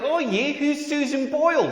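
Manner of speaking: slow, high mock Australian drawl; Australian accent. Comedian as puzzled Australian flatmate asking about a singer, Australian accent. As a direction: stretch it out in a put-on Australian accent. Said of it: "that's exactly what Australians sound like".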